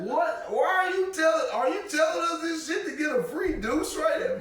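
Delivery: high, loud, disbelieving retort; incredulous outburst breaking into a laugh; retold incredulity. Storyteller replays his reaction to being asked, high incredulous protest.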